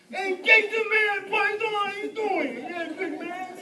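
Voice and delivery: hammed-up, high Jersey buddy talk; Jersey accent. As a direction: holler it like a guy from Jersey. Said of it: "I get these Jersey accents".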